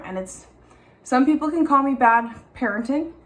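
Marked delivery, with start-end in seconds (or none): none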